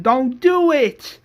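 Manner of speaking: high, loud, drawn-out plea; acted scream. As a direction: yell a slow, desperate warning. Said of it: "are all screaming".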